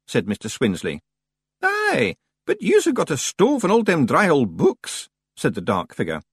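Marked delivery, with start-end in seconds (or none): 1.6-5.1 high-pitched broad Scots; puzzled Scots stranger. Acted. Stranger pointing out fuel to librarians, high broad Scots.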